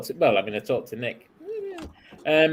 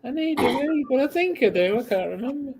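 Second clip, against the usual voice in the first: put-on frail old man voice; 12 semitones higher than usual. Continuing old man role.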